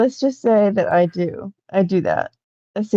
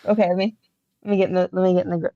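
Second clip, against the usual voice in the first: normal talking voice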